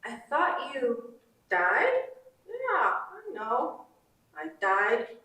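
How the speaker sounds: lightly voiced Navajo mom; Navajo mother accent. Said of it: "a Navajo woman's accent".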